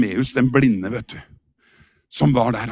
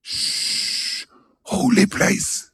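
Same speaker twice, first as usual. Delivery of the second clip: mock pious shush; 3 semitones higher, much slower than usual. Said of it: "og da sa folk".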